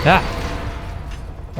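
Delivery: sudden startled gasp-yelp; jolted breathy cry; scared horror game streamer. Real, not acted. Startled by enemy.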